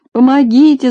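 high, loud wailing cry; a policeman stuck in a tree wails for help; howling for help. Acted. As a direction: howl it in panic. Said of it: "завыл полицейский".

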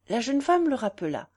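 ordinary delivery

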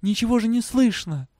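put-on high-pitched girl thinking to herself; girl's thought. A narrator's reading. Girl unable to hear over prisoners, high-voiced thought. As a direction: voice her thought in a high register. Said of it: "подумала она".

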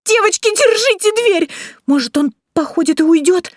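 high, loud cry for help; frantic squeaky pleading; panicked squealing. A narrator's reading. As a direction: shriek the order, then stay high and frightened. Vasilisa panics, bear at the door.

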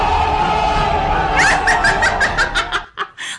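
high cackling witch laugh; witch cackle. Real, not acted. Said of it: "mi risa de bruja".

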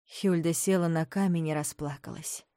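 ordinary delivery

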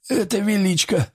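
high-pitched, bewildered wounded man. A narrator's reading. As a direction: say it at a loss, pitched well up. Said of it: "растерянно пробормотал раненый".

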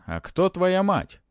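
high, slow probing question; mummy questioning with a touch of unease; alarming undertone. A narrator's reading. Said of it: "В голосе мумии прозвучали тревожные".

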